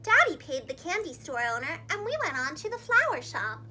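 plain reading voice

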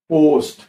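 পোস্ট with a loud, stretched, exaggerated O; overdone teacher's demo of পোস্ট, O held long; mouth-distorted pronunciation. Shown as a demonstration. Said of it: "মুখটা বিকৃত করে বলছি".

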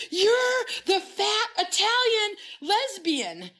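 high, slow fangirl squeal; imitated scream. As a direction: stretch it out in a star-struck shriek. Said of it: "screaming, like, screaming".

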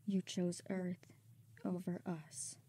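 very soft, fading to a whisper; near-whispered heartbreak; hurt, betrayed murmur. Acted. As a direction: accuse him faintly, wounded. Injured, betrayed woman after blast.

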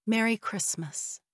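soft, slow hushed wish; whispered. Acted. Narrator wishing her drowsy mother goodnight, softly and slowly. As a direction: breathe it softly so she can sleep. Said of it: "I whispered".

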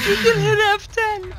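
high, tearful laughing voice; real helpless laughter over the words; laughing through words. Real, not acted. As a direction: try to finish while cracking up. Relapsing into laughter mid-sentence.

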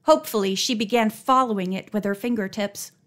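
ordinary delivery

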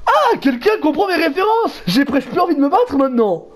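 high, slowed delighted villain exclamation; villain thrilled someone gets the references. Retold or imitated. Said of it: "Et là, le mec sort". (méchant ravi, voix haute et lente)

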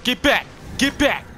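loud urgent commands; yelling at monsters. Real, not acted. Player shouting at creatures to back off, loud. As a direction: shove them away with your voice.

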